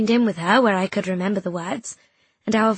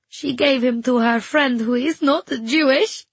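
higher, louder, distressed voice; crying girl; 4 semitones higher, 5 dB louder than usual. Acted. Crying girl confiding in her friend, distressed. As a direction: pour it out in a raised voice.

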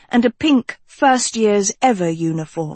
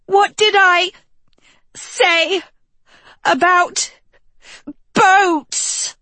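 high, halting whimper with a cry; mewling; 10 semitones higher, slower than usual. A narrator's reading. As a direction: whimper each word miserably. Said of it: "Sophie mewled".

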